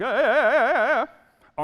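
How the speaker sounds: high, loud panicked ah ah ah; inner panic. Retold or imitated. Speaker telling the audience how she felt inside, panicked high yelps. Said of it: "On the outside, I was like".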